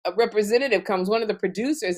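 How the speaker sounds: usual speaking voice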